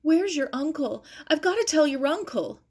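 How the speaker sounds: high, loud and breathless with alarm; frightened girl blurting the bad news; panicked cry. Maggie in panic about boat.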